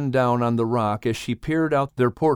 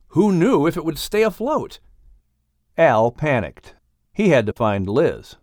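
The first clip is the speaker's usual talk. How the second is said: ordinary delivery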